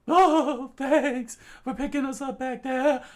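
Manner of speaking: high loud voice, teasing then thankful; grateful girl. Acted. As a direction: thank Chikara warmly. Character high.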